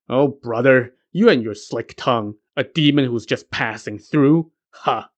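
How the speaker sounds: usual speaking voice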